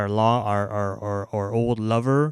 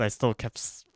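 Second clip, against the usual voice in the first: usual speaking voice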